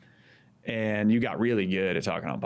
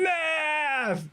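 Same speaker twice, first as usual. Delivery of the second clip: very high shouted crowd cheer; imitating a roaring crowd. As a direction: yell it like a whole rally. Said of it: "all the crowd just starts going".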